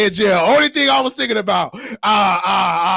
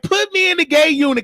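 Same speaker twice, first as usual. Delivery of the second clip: eager plea; 8 semitones higher than usual. Retold or imitated. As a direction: plead eagerly, pitched way up. Retells the same eager request.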